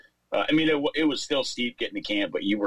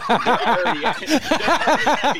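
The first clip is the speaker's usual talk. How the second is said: high, squeaky through laughter; words squeezed out laughing; 13 semitones higher, 7 dB louder than usual. Real, not acted. Laughing about cheap poles.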